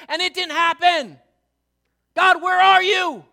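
a believer shouting in anger at God; angry cry to God. Acted. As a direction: hurl it at God in anguish. Voicing angry prayer to God.

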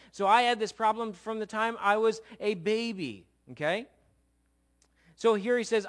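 loud, rising sermon voice; preaching at full force. Acted. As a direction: preach it out with mounting fire.

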